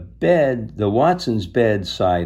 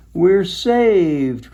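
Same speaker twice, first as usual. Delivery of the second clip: high, loud, relieved cry; loud happy high cry; cried out; 10 semitones higher, 6 dB louder than usual. A narrator's reading. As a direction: burst out in relief. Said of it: "cried Missus Watson".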